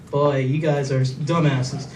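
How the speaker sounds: low, slow, soft inner voice; muttered aside. Retold or imitated. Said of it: "I think to myself".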